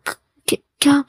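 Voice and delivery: soft, halting stutter on the first sound; flustered stammer. Acted. Man caught off guard by his sweetheart's question, flustered stammer. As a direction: trip nervously into the question.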